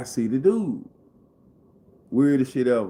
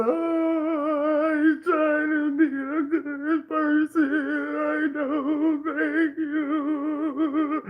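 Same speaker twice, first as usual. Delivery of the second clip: very high, slow wailing falsetto; sing-cry it like a blubbering man; mock wailing sob; much slower than usual. The weeping neighbor answering his kindness, in a high wailing sob.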